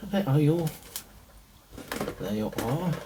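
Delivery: lightly put-on German accent; a hint of playful German accent while searching. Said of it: "Don't know why I'm doing a German accent".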